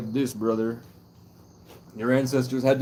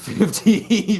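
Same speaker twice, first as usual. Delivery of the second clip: high, loud, laughing through the words; 11 semitones higher, 10 dB louder than usual. Real, not acted. Joking to viewers about stripping, laughing. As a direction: crack up at his own offer.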